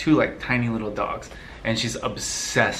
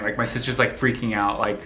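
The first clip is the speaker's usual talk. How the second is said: ordinary talk, nothing marked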